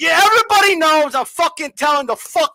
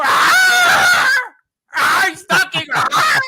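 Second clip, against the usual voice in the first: hammed-up frightened yelps, much higher and louder; mock scared cries; 11 semitones higher, 3 dB louder, much slower than usual. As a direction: shriek like a scared man, overdone for laughs. Mocking a scared opponent.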